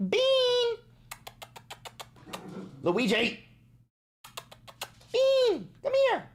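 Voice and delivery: high-pitched call to the pets; sharp, high scolding shout across the room. Real, not acted. Owner to Beans and Luigi at the door, scolding call.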